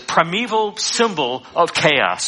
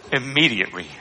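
ordinary talk

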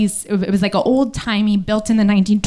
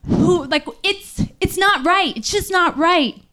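higher, mock yell from down the platform; the stranger walking away and yelling back, mock outrage; retold yell; 8 semitones higher than usual. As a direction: call it back over your shoulder, half-hearted outrage. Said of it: "he would yell back".

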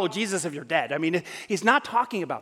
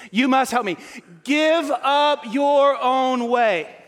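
loud, very slow, every word pressed; forced out emphatic; 6 semitones higher, 5 dB louder, slower than usual. Real, not acted. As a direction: wring the words out against yourself. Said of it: "Help me say this".

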